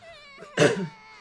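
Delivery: high wailing sob; loud sob. A narrator's reading. Girl wailing for her brother. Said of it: "begging through her tears".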